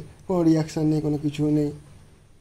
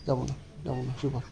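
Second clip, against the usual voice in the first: soft soothing; 4 semitones lower, 9 dB quieter, much faster than usual. Real, not acted. Soothing the dog, won't leave.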